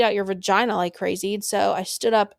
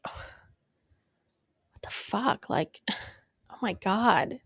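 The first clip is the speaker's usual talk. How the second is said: hushed shock; 6 dB quieter than usual. Retold or imitated. Shocked first sight of preemie.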